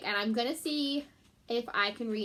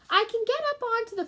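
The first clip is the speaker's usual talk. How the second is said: higher, lightly voiced little mouse; Stuart the mouse; 12 semitones higher, 7 dB louder than usual. Stuart telling his father about the faucet, small mouse voice. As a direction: give Stuart a small eager voice.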